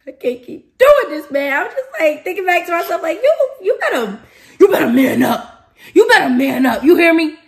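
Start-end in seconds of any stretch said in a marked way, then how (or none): 0.1-1.5 loud, high, drawn-out complaint; worked-up outburst. Retold or imitated. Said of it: "I'm so excited". 3.2-7.4 loud, high, hammed-up telling-off; over-excited self-pep-talk. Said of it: "I'm so excited".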